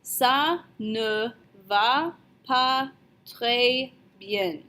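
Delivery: "Ça ne va pas très bien" one word at a time; very slow, separated French syllables; slow model pronunciation. Shown as a demonstration. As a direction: teach "Ça ne va pas très bien" with a gap after each word. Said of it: "you're going to say".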